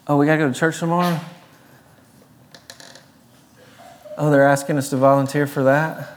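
rushed, put-upon whine about church; fast reluctant complaint; mock grumbling.

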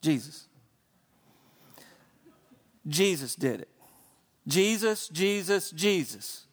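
Jesus, Jesus said low and deliberate, each one set apart; saying the name. Shown as a demonstration. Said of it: "I'm gonna say it again".

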